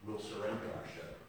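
a quiet resigned surrender; softly giving up. Acted. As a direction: let it sink, all fight gone. Sailor giving up, beaten.

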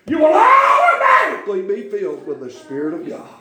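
mock a self-help program, teasing; preaching. Preacher teasing the congregation about ten-step programs, laughing.

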